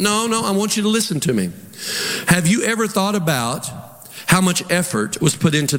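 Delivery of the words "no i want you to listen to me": ordinary talk, nothing marked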